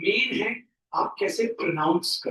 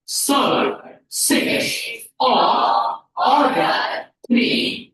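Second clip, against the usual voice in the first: word-by-word reading; much slower than usual. Shown as a demonstration. Teacher pronounces it a last time, word by word. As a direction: punch out each word on its own. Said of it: "आखिरी बार आपके सामने pronounce करते हैं".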